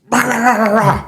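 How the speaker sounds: wordless "rah rah" rant, pitched high; angry client ranting. Retold or imitated. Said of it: "And the guy goes".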